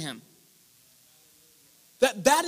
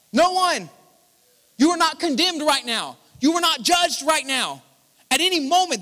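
louder, higher preaching, proclaiming hard; full-force preaching; 4 semitones higher, 4 dB louder than usual. Acted. Preacher to his congregation, proclaiming at full force. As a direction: drive the promise home to the room.

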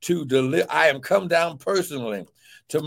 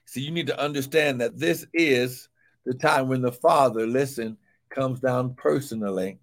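normal talking voice

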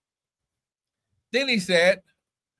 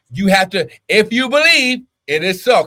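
higher and louder; fervent preaching; 4 semitones higher, 7 dB louder than usual. Acted. Preacher urging the church to believe, fervent. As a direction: build the phrase up with fire.

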